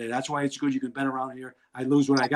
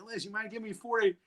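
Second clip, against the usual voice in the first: sheepish ask; 6 semitones higher, 6 dB quieter than usual. Retold or imitated. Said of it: "And I said".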